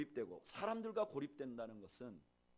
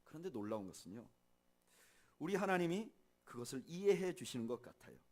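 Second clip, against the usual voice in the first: ordinary talk, nothing marked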